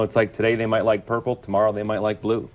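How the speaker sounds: ordinary talk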